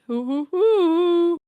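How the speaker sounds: put-on high hooting old lady chuckle; teasing grandma titter; granny laugh. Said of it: "my Nana voice".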